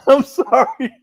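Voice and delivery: giggling through sorry; laughing apology. Real, not acted. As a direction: apologize while cracking up. Laughing after his own joke.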